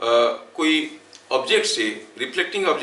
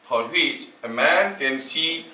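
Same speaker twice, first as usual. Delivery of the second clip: slow, word-by-word dictation with long pauses; dictating notes; 3 semitones lower, much slower than usual. Acted. Teacher dictating a definition to the class, slow dictation. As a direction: dictate each phrase slowly so students can write it down.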